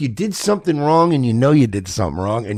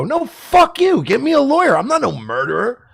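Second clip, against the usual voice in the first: indignant innocent; 11 semitones higher than usual. Retold or imitated. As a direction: refuse like a wronged man. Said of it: "And you go".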